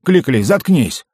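high, loud, drawn-out order; exasperated loud rebuke; angry command. A narrator's reading. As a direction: shut the goblin up crossly. Gnome snaps at pestering goblin.

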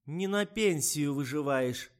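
loud, high and slow; the old woman raising her voice. A narrator's reading. Old woman raises her voice at the writer, loud and slow. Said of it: "повысила голос тётка".

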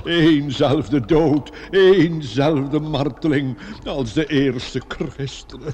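slow, drawn-out solemn intoning. Acted. Priest speaking of martyrdom to the teacher, slow solemn intoning.

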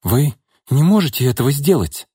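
a touch of alarm. A narrator's reading. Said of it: "разволновалась Наташа".

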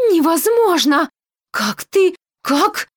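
震惊难以置信; stunned disbelief. A narrator's reading. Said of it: "бормочет она".

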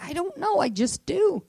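high-pitched, helpless protest; acted-out cluelessness; baffled reply. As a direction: squeak it up like a bewildered kid. Acting out her baffled reply.

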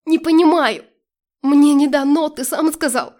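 loud, high cry of refusal; angry shout. A narrator's reading. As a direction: shout it out, cutting them off. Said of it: "крикнула теперь я". (гневный выкрик, громко и высоко)

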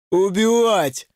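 strained cry at ordinary volume; narrated yell, held back. A narrator's reading. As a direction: cry it out in desperation. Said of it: "выкрикнул Вова".

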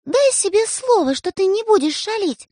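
high, sugary coaxing; ingratiating coax. A narrator's reading. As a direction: coax him into a promise. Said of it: "говорит она как можно вкрадчивее".

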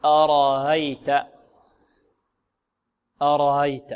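أرأيت with the second hamza softened, no glottal stop; eased hamza. Shown as a demonstration. Quran teacher modelling Qalun's reading, eased hamza in أرأيت. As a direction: draw out أرأيت slowly, gliding over the second hamza. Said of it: "تسهل الثاني".

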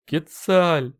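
high plaintive cry that sinks away; crestfallen. A narrator's reading. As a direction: say the name with a sinking heart. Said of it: "произнёс упавшим голосом Талтенолли". (упавшим голосом, жалобно)

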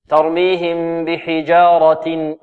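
ترميهم بحجارة with heavy raa and light jeem; slow Quranic recitation. Shown as a demonstration. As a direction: stretch ترميهم بحجارة slowly. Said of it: "الراء هنا ماذا؟ مفخمة".

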